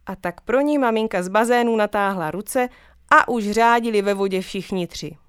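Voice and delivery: ordinary delivery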